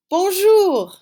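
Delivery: Bonjour sung out high and loud; cheerful loud greeting. Shown as a demonstration. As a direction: call out a big classroom hello. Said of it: "I say the red".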